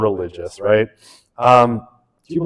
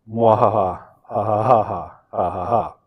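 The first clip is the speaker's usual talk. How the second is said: evil cackle; 2 semitones lower than usual. Pretend. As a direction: cackle like a cartoon mad scientist. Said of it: "my evil cackle needs some work".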